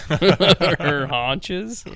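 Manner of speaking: laughing in disbelief; incredulous laughing repeat of her haunches. Real, not acted. Friend laughing in shock at the word, repeating it. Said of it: "Excuse me?".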